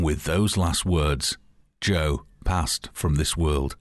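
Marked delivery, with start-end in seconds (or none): none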